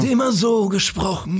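hoch und langsam, pathetischer Doku-Erzähler; Doku-Sprecherstimme. Retold or imitated. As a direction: wie ein getragener Geschichtsdoku-Sprecher. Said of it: "diese Dokus gesprochen hat". (imitiert den Sprecher der Hitler-Dokus)

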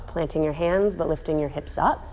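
soft, unhurried cool-down guidance; calm yoga voice. Acted. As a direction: ease the class down gently, softly.

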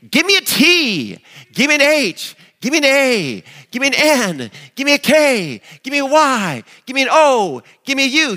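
high, loud cheerleader chant. Retold or imitated. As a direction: belt each letter like a cheer captain. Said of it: "She stands on the chair and she says".

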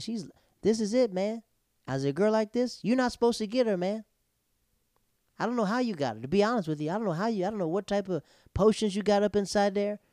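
high-pitched, emphatic disbelief; incredulous pep talk. Retold or imitated. Man telling his boy she's out of his league, incredulous.

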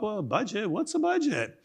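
high, soft mock-puzzled voice; clueless friend. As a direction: play someone who has never heard of budgets. Acted friend clueless about budgets.

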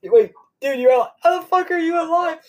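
rising, high-pitched disbelief; hammed-up bewilderment; mock astonishment. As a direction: imitate a stunned friend, voice climbing high. Marty stunned Doc is alive.

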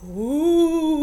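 hooting ghostly ooh. Shown as a demonstration. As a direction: sound like a ghost. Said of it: "try to sound like a ghost".

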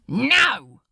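loud, sharp no; shouted refusal. Acted. Crowley refusing the angel, loud. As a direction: shout him down.

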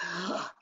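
a quick, soft relieved gasp; mock gasp. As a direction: gasp as if thrown a lifeline. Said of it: "So I go like".

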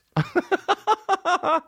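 real high-pitched laugh breaking out; high burst of laughter. Real, not acted. Podcaster laughing at the Cohen clip, high real laugh. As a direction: crack up, squeaky and high.

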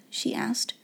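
ordinary delivery

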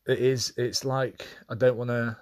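fighting back tears mid-sentence; getting emotional. Real, not acted. Widower talking about his late wife, voice catching. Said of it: "I'm kinda getting emotional now".

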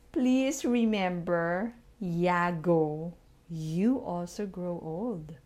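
slow, spelled-out repeat; repeating for the tenth time. Shown as a demonstration. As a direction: say it slowly, word by word. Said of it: "Please repeat with me".

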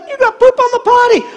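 put-on very high little-girl tantrum voice; high mock kid protest; accusing granddaughter. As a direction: imitate a child scolding Grandpa. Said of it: "and then she's like".

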